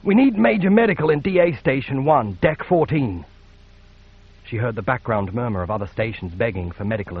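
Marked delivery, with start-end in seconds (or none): 0.0-3.3 raised raw urgent request; urgent hoarse call for help; gritty plaintive call. Acted. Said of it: "her voice gritty and plaintive".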